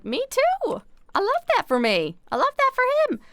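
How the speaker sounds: squeaky high gushing. Retold or imitated. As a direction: squeal it like a delighted fan. Gushing reply to the youtuber.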